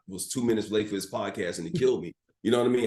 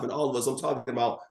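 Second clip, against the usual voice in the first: usual speaking voice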